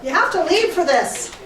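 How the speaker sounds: loud call to someone out of sight; yelling across the room. Real, not acted. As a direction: raise the voice to carry across the room. Answering Sky's what from afar.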